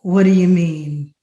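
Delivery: lightly voiced innocent question; retold friend. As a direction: answer evenly, as the unbothered friend. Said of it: "He says".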